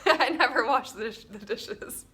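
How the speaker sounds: cracking up mid-confession; laughing. Real, not acted. Wife laughing at her own dish confession: giggling.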